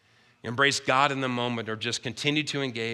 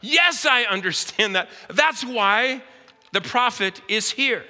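slow, raised, emphatic answer; a touch of divine insistence; voice of God; 8 semitones higher, 4 dB louder, slower than usual. Retold or imitated. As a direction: answer her slowly and firmly. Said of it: "the voice of God is".

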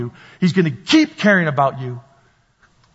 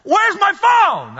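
very high, drawn-out wail; hammed-up high-pitched shout; comic panicked yell; slower than usual. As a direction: holler it slowly in mock desperation. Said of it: "I'm talking to the person and I go".

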